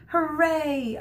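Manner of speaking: high, loud happy cheer; a child's delighted hooray. Retold or imitated. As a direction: cheer like a thrilled little boy. Boy cheers at good news.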